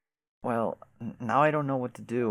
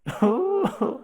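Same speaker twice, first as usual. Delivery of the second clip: teary laugh; 12 semitones higher, 5 dB louder than usual. Acted. Laughing through tears.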